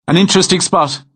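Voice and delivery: easygoing Irish farmer's brogue; musical Irish brogue. Acted. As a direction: sing it lightly in an Irish brogue. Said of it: "in a musical West County brogue".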